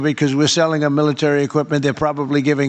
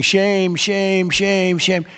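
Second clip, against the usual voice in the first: higher-pitched chant at ordinary volume, a mild mock scream; 4 semitones higher, much slower than usual. Retelling a woman's protest at the scene, mild mock screaming. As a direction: mildly imitate a screaming protester. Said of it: "there was a woman screaming".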